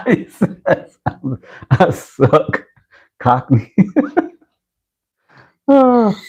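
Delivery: high-pitched, through real laughter; helpless amused laughing voice. Real, not acted. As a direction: read it out while cracking up. Reads a jab while laughing.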